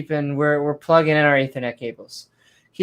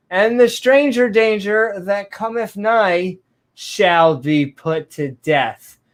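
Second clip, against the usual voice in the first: 1 mock scripture; 4 semitones higher, slower than usual.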